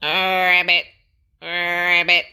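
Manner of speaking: loud croaking ribbit; a frog ending the story. A narrator's reading.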